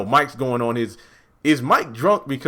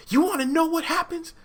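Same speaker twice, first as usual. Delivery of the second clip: much higher, sing-song teasing; excited reveal. Acted. Vlogger hyping viewers before the twist, excited and high. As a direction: build hype, voice jumping up.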